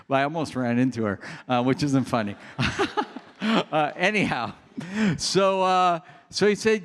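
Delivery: ordinary talk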